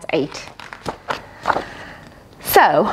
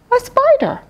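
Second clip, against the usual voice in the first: surprised reveal; 14 semitones higher than usual. Acted.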